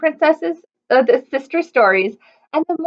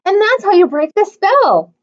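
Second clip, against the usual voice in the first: higher, lightly louder insistence; haughty princess; 7 semitones higher, 5 dB louder than usual. A narrator's reading. Arabella defending the frog to Martha, higher and louder. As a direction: insist with a lifted voice. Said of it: "said Arabella".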